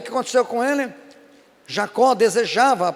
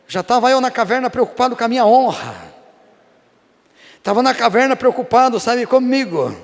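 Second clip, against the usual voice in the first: fervent preaching; 4 semitones higher, 7 dB louder than usual. Acted. Preaching at full force.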